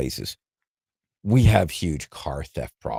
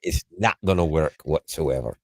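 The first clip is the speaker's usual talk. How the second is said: put-on Scots accent; Scottish accent; 3 semitones lower than usual. Shown as a demonstration. Showing the co-host the right regional accent, Scottish accent. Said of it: "If you go into a Scottish accent".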